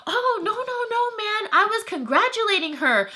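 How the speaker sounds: high-pitched imitation of hasty denial; mocking backpedaler. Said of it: "you should have seen his tone of voice".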